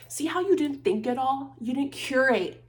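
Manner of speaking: worked-up, accusatory outburst; heated rant. Real, not acted. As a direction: tear into them, getting too heated. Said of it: "getting too heated about this".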